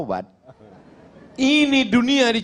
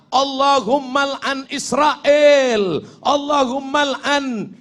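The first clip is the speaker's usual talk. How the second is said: shouted curse prayer; 6 semitones higher, slower than usual. Pretend. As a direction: hurl the curse at full voice.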